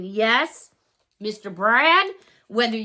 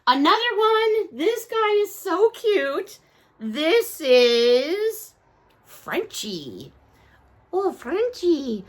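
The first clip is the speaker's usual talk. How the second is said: usual speaking voice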